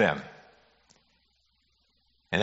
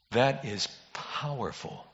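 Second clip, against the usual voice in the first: slow, hushed and much softer; hushed awe; 9 dB quieter than usual. Real, not acted. Pastor to congregation, slow hushed awe. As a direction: let it sink in, barely above a breath.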